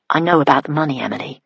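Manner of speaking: usual speaking voice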